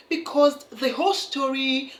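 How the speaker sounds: ordinary talk, nothing marked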